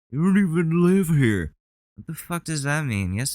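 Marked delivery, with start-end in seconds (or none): 0.1-1.5 higher, sneering imitation of the man; mocking the harasser. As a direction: parrot his words with a mocking lilt. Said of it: "And he's doing this".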